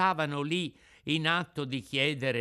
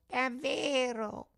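very high, long drawn-out wail; echoing cat; 13 semitones higher, much slower than usual. Acted. Cat echoes the begging fox, wailing. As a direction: parrot the word in a thin feline whine. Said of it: "ripeté il gatto".

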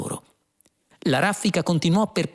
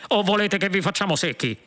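higher-pitched policeman's threat; yelled threat; 7 semitones higher than usual. A narrator's reading. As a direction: threaten them with a mild shout. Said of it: "urlò uno dei poliziotti".

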